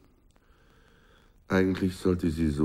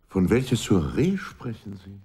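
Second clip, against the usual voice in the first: ordinary talk, nothing marked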